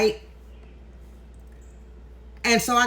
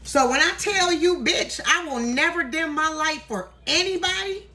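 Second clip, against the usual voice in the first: higher, rising, fired up and defiant; emotional outburst; 7 semitones higher than usual. Real, not acted. Vowing to her followers, a higher, emotional defiant declaration. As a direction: declare it with a swell of feeling. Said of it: "this is the reason why I'm getting so emotional".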